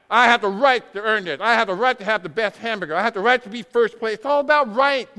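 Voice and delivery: loud sneering impression of an entitled crowd; mock entitled demand. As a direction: play the spoiled voice demanding rights. Mocking the entitled American voice.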